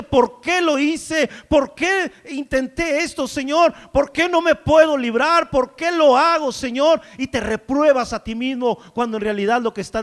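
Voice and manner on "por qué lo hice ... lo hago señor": higher, louder, slow anguished questioning; the regretful believer pleads loudly with God; anguished lament. Acted. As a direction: cry out in regret to the Lord. Voicing a despairing believer.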